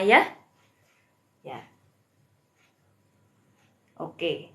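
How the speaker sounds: normal talking voice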